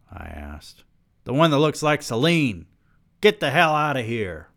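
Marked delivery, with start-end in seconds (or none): 1.3-4.5 high-pitched, insistent yell. A narrator's reading. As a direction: throw him out with a raised voice. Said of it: "he yelled".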